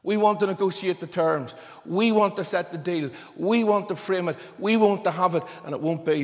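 preacher rousing the congregation, loud and forceful; fervent preaching. Acted. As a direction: drive it home from the pulpit. Preaching builds to full force.

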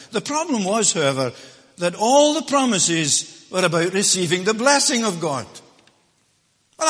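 loud, high, ringing pulpit preaching; full-force preaching. Acted. Preacher proclaiming blessing to the church, loud and ringing. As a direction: drive it home to the congregation.

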